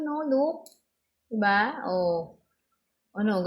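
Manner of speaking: ordinary talk, nothing marked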